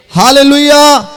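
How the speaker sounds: high, sustained rallying shout; loud hallelujah shout. Real, not acted. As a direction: shout it out to rouse the congregation. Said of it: "కొంచెం గట్టిగా చెప్తారా". (గట్టిగా హల్లెలూయా నినాదం)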